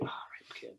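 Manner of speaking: breathy whispered aside. Retold or imitated. Muttering to himself near the rude guy, whispered. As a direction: whisper it under your breath. Quietly resigned at festival nuisance.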